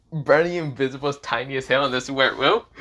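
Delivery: plain reading voice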